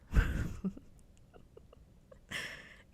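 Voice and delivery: sudden breathy laugh; short laugh. Real, not acted. Streamer laughing at own remark, short laugh. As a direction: burst briefly into a hushed laugh.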